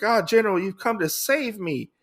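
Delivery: high mock damsel exclamation; imitated lady. Lady Asano to the general come to save her, imitated high exclamation.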